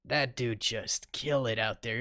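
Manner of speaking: usual speaking voice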